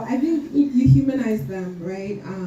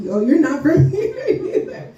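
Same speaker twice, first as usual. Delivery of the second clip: imitating Haitians, breaking into a laugh mid-line; higher and louder, laugh through it; laughing quote; 9 semitones higher, 4 dB louder than usual. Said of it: "they're like, they're like".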